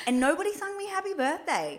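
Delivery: high, loud whining complaint; mock whine. Real, not acted. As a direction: whine it playfully. Complaining about lost birthday.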